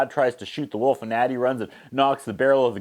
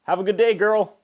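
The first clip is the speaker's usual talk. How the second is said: much higher mock friendly voice; sing-song handing over; cheery creep; 9 semitones higher than usual. Scarred man handing the wolf back, mock cheerful. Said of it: "and he goes".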